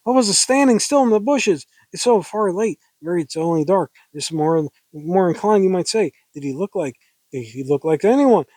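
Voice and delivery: high, agitated woman's dialogue; hammed-up nervous heroine; hysterical woman. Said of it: "I like being kinda hysterical".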